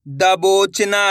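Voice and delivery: slow spelling. Shown as a demonstration. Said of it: "अब अपन direct मात्रा का उच्चारण करके पढ़ेंगे".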